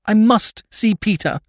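high, slow, insistent plea; distraught lady demanding help; desperate cry. A narrator's reading. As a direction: cry it out high, dragging the words. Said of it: "cried Lady Mary".

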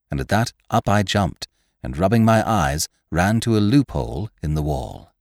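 plain reading voice

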